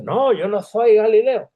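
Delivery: high, loud, slow protest; panicked denial. Retold or imitated. Peter denies knowing Jesus, loud and high. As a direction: deny it too hard and give yourself away. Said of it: "Y entonces él dice".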